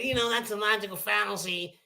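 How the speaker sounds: lightly mock a polite debater. Mocking the powder puff debaters, prim and soft. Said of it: "They want us to talk like this".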